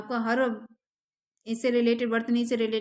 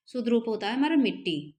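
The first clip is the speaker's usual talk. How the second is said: ordinary talk, nothing marked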